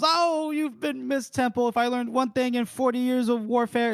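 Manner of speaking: high-pitched, effusive Casca-style flourish; theatrical gushing. Acted. Casca gushing to Temple, acted.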